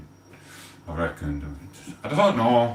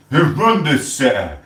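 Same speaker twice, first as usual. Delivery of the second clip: angry; 6 semitones higher, 5 dB louder than usual. Real, not acted. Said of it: "Sorry, I'm getting angry".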